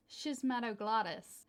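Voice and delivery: Schismatoglottis in an American accent, shiz-ma-do-GLAH-dus. Shown as a demonstration. Copying an American YouTube video, saying Schismatoglottis in an American accent. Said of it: "it comes up in an American accent".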